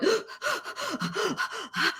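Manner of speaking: sharp, high, breathy gasp between sobs; a mock sob caught in the throat; sobbing gasp. Shown as a demonstration. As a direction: gasp in as if choking on tears. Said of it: "you're sobbing to the point where you're going".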